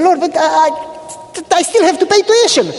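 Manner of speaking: high, pleading cry to God; desperate plea. Retold or imitated. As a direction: beg the Lord, voice climbing high. Pastor pleading with the Lord.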